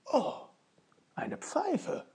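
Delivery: hoch und überrascht, fast gehaucht; erfreuter Ausruf. Acted. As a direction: entdeckt staunend etwas Tolles. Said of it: "rief es aus". (Ofenmichel freut sich über den Fund, staunend)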